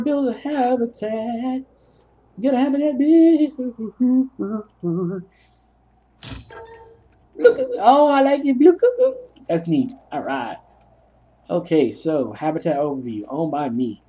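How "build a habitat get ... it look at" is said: much higher, rising thrilled voice; excited. Real, not acted. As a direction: get giddy over the new build. Excited building a habitat.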